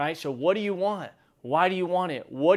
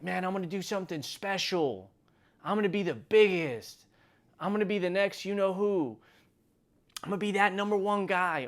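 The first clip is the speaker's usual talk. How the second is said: imitated big talker; 6 semitones higher than usual. Imitates newcomers boasting of big plans.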